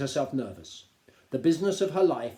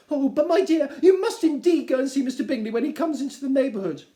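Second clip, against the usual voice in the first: much higher and louder, whining; exasperated Mrs Bennet; 12 semitones higher, 11 dB louder than usual. Acted. Wife complaining to her tiresome husband, high and whining. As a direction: scold him shrilly.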